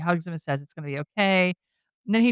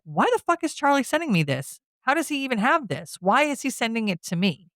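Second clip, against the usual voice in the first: retold outrage, pitched higher; half-acted demand, voice climbing; angry disbelief; 7 semitones higher than usual. Josh demanding why Charlie sends him this, heated. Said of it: "he's like".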